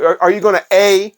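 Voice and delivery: ordinary talk, nothing marked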